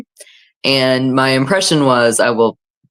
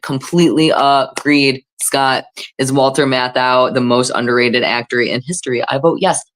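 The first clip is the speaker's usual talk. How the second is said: usual speaking voice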